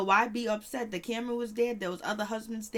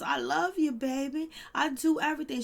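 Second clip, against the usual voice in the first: higher, coaxing husband voice; husband begging his wife not to be mad; pleading husband; 6 semitones higher, 3 dB louder than usual. Retold or imitated. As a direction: sweet-talk her so she calms down.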